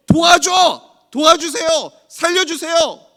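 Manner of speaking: very loud, high desperate shout, first word slow; preacher showing the congregation how to cry out; crying out to God. Shown as a demonstration. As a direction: cry out with all your strength. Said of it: "그리고 부르짖으십시오".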